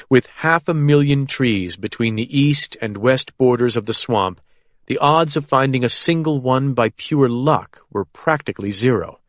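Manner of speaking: ordinary delivery, nothing marked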